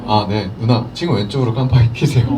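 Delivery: calm, low driving instructions; composed chest voice. Retold or imitated. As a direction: put on a very calm, low tone to hide the nerves. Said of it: "흉성을 써서 아주 차분하게 얘기를 하지만".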